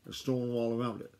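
plain reading voice

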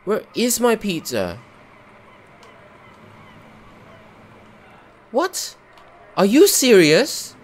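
high, loud incredulous cry, slowing at the end; can't believe it's gone; indignant disbelief. Real, not acted. Player shouting at the buggy game, incredulous. Pizza disappeared again.

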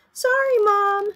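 put-on high, loud little-kid voice; child's apology. Sammy apologizes to Mom for eating the table, childlike. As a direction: pipe up as a sorry little one. Young shark apologizes to mother.

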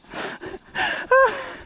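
high helpless giggle; squeaky burst of laughter. Real, not acted. Rider giggling helplessly in the saddle. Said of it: "I can't stop laughing".

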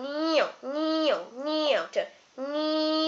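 repeated short motor whines; imitated clipper whine. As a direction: mimic clippers making passes, then slipping.

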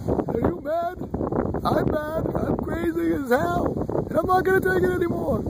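mock-crazy high falsetto rant; squeaky falsetto. Vlogger jokingly plays crazy in a high voice. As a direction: squeak it up like a cartoon madman.

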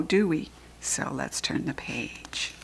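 ordinary delivery, nothing marked

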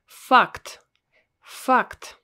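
Fact with an open Russian a; Fact, loud and clear. Shown as a demonstration. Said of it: "It's a fact".

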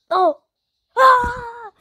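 sudden high excited shout; excited yelp. Real, not acted. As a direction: yelp with joy. Teammate's big play in game.

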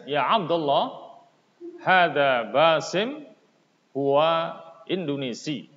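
loud, careful reading of each line of the dialogue; model reading with intonation. Shown as a demonstration. Teacher reads Arabic dialogue to pupils, loud model reading. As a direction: read it out clearly so pupils can copy the tune. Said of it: "dengarkan baik-baik dan perhatikan intonasinya".

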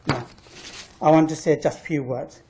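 ordinary talk

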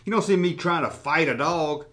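ordinary talk, nothing marked

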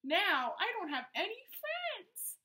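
hammed-up sob story for kids; high childlike voice, lightly sobbing; sobbing remora. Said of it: "she sobbed".

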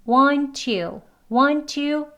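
WANT TO with both t sounds said; two separate words, "want" and "to"; full form. Shown as a demonstration. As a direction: sound out "want to" slowly and unreduced. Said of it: "요 앞에 이제 영상에서 wanna".

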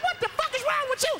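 high-pitched indignant outburst; retold outrage, pitch shooting up; outraged. Man to his date after a fart in his car, high-pitched outrage.

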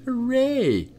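high sarcastic hooray; a playful mock celebration; mock cheer.

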